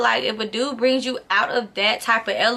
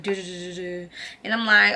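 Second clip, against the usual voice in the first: normal talking voice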